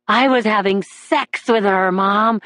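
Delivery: ordinary delivery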